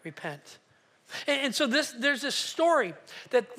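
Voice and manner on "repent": repent said much lower and softer; word dropped low. Shown as a demonstration. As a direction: drop repent to a low, soft voice. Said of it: "say this again".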